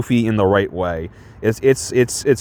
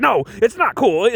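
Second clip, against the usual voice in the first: indignant; 11 semitones higher than usual. Retold or imitated. Retells indignant sheriff.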